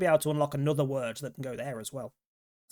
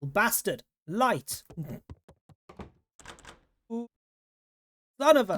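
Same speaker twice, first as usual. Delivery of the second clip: higher, louder furious yelling; angry shout; 6 semitones higher, 4 dB louder, slower than usual. Real, not acted. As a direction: yell in outrage at the betrayal. Lights switched off, trapped.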